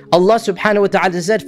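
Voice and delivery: ordinary talk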